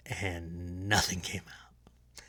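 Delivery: hushed secret whisper, slow. Acted. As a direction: lean in and whisper it. Telling an inside secret.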